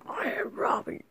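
breathy whispered hero intro. Pretend. Toy hero announcing himself, whispered. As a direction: whisper it like a secret.

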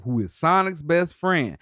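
ordinary talk

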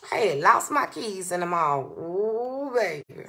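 ordinary talk, nothing marked

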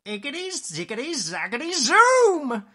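high incantation building to a loud finish; storybook magic spell, rising in force; witch casting spell. Acted. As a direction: chant it, exploding on the last word. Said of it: "she muttered a spell".

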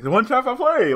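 high, loud whiny mock cry; mock-triumphant opponent shouting. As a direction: squeal it like a gloating rival. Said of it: "He's like".

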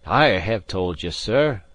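lightly voiced Scots gentleman, firm and proud; proud Highland gentleman. Gentleman firmly refusing the captain, lightly voiced proud Highlander.

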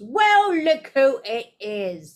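slow, sneering lazy drawl, a hint of a schoolboy bully; lightly voiced spoiled boy taunting from the doorway. A narrator's reading. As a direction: drag the words out lazily and mockingly. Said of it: "in his usual lazy drawl".

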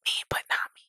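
hushed breathy whisper. Pretend. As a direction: whisper it, barely voiced. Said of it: "Why are you whispering?".

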